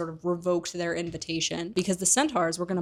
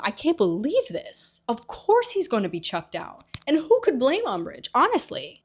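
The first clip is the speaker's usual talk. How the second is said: fuming; 6 semitones higher, 4 dB louder than usual. Retold or imitated. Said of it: "Hermione is fuming".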